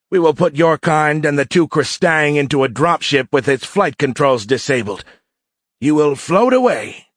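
high, loud-edged, taunting cadet voice; menacing alien cadet. A narrator's reading. Cadet leader threatens his prisoners, mocking. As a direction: gloat over a captive in a high voice.

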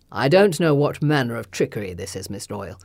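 low, cold lordly rebuke; stern lord voice. Acted. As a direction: dismiss her coldly. Lord Denby rebukes her.

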